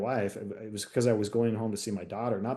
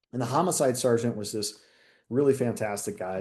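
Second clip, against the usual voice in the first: normal talking voice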